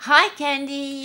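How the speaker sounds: high, loud cheerful shout; loud bright shout; shouted greeting. A narrator's reading. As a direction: call out the hello. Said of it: "shouted Daniela".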